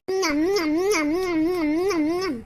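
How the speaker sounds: savouring hum. Acted. Savouring the sister-in-law's dosa.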